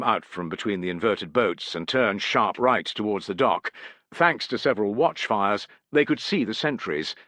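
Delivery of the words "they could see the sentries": plain reading voice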